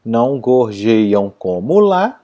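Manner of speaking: slow, measured "Não gorjeiam como lá"; measured scansion. Shown as a demonstration. Teacher showing the last verse coincides, slow measured recitation. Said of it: "o último coincide".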